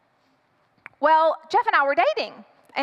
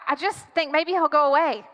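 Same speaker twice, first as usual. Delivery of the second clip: ordinary delivery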